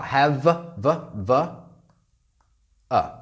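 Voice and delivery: "Have" then held "v, v, v" sliding into "a"; v linked into a. Shown as a demonstration. Teacher shows students the v linking into "a". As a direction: buzz the "v" and run it into "a". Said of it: "is this consonant to vowel".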